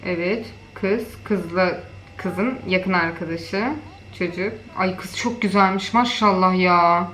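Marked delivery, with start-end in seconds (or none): none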